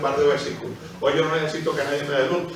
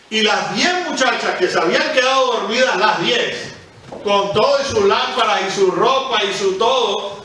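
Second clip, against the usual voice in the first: higher, louder storytelling preach; fervent preaching; 8 semitones higher, 5 dB louder than usual. Acted. Preacher telling the ten virgins parable, fervent raised preaching. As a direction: drive the parable home with fire. Retelling parable with rising fervor.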